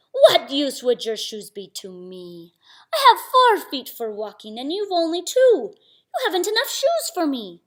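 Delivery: loud tiger objecting, rising in pitch; scornful tiger. Acted. Tiger turning down Samba's shoes, loud scornful objection. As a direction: build to a high, loud complaint.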